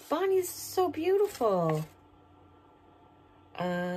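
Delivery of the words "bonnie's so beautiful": ordinary talk, nothing marked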